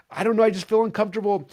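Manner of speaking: high, loud, defensive whine; flustered protest. Retold or imitated. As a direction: imitate yourself squirming under pressure. Acting his own flustered protest.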